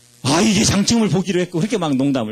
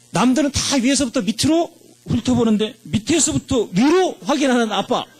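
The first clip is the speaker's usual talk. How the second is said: put-on higher, boyish brag about his humility; boasting son; 7 semitones higher than usual. Son bragging to his father about grades, higher and cheeky. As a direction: play the cheeky son showing off to dad.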